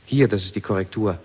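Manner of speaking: usual speaking voice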